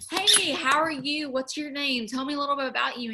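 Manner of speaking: mock friendly small-talk voice; bubbly greeter. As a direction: greet a stranger with big cheer. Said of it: "they're like".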